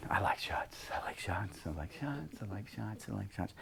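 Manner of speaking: calm self-talk, barely a breath; hushed, repeating self-reassurance; whispered mantra. Shown as a demonstration. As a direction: whisper it to yourself over and over, fading. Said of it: "I do what we've been doing".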